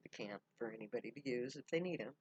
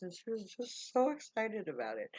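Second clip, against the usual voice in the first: higher and louder, gushing; excited narration; 8 semitones higher, 6 dB louder than usual. A narrator's reading. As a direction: bubble over with excitement.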